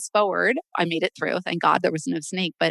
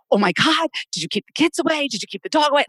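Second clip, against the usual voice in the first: higher-pitched, mock-frantic questioning; retold panic; 8 semitones higher, faster than usual. Wife quizzing husband after the snake sighting, mock panic. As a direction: act out a panicked check on the family.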